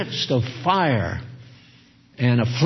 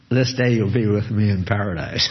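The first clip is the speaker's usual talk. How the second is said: lightly voiced gentle Jesus, a touch lower and quick; nicely; 6 semitones lower, faster than usual. Jesus kindly reassuring the thief on the cross, lightly gentle. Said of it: "Jesus very nicely said".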